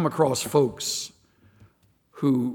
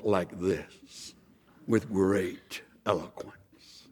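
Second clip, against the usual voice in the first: slow, low, softer orator's voice; eloquent chaplain; 7 semitones lower, 7 dB quieter, much slower than usual. Retold or imitated. Pastor mimicking the Senate chaplain's wonderful voice, low and eloquent. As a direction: imitate a grand, measured chaplain. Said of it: "wonderful voice. I'm trying to act like it".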